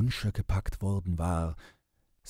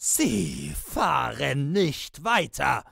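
high, slow, drawn-out roar; inhuman, enraged yell; bellowing; 8 semitones higher, 4 dB louder, slower than usual. A narrator's reading. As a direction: roar the order out, word by word. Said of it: "begann Piepsam zu schreien und zu schimpfen".

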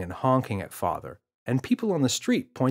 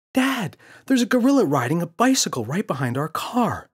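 put-on excited child; 8 semitones higher, 5 dB louder than usual. Boy calling dad.

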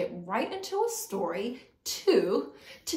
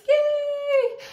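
excited cheer; 9 dB louder than usual. Real, not acted. Cheer after the song.

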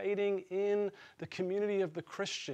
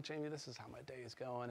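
much softer, low-voiced admission; quiet confession; 5 semitones lower, 16 dB quieter, faster than usual. Confessing a bad day to his friend Jamie, hushed and softer. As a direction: confide it almost under your breath. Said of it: "I'm like".

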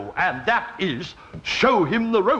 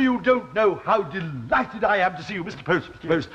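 ordinary talk, nothing marked

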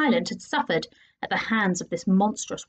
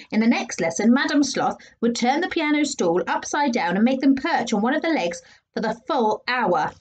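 ordinary delivery

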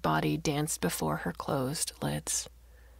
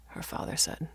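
plain reading voice

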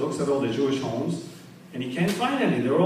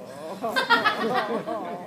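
own laugh; 6 semitones higher than usual. Real, not acted.

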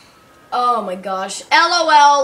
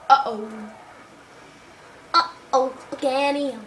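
usual speaking voice

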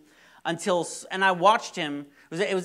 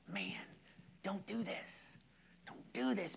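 soft plea; 3 semitones higher, 14 dB quieter than usual. Retold or imitated. Pleading with a friend.

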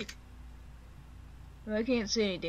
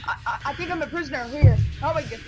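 high, loud, fast panicking; panicked; 8 semitones higher, 6 dB louder than usual. Acted. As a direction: stammer out in rising panic. Panicking trapped character.

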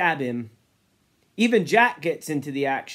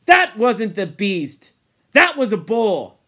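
loud, emphatic correction; firm contradiction; 4 semitones higher, 7 dB louder than usual. Acted. Jack correcting Ralph about the beast, emphatic. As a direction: set him straight forcefully.

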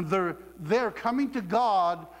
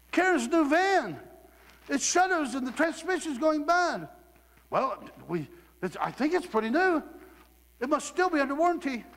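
higher, fretful retold panic; retelling the old panicked self over the van, higher and fretful; mock panic; 7 semitones higher than usual. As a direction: act out the old worried self.

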